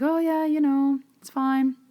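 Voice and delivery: high, breezy no-thanks voice; awkward brush-off. Retold or imitated. Playing down the baby's attachment.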